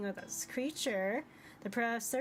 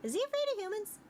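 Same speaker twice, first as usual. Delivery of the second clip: high character voice; 11 semitones higher, faster than usual. Acted. Character asks about professor.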